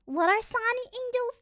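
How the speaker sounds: usual speaking voice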